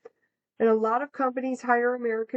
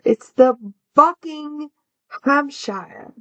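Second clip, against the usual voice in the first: Buckinghamshire drawn out slowly, loud and higher; correcting stress; 4 semitones higher, 7 dB louder, much slower than usual. Real, not acted. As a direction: spell out Buckinghamshire to fix the mix-up. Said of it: "it's not the fucking Hampshire".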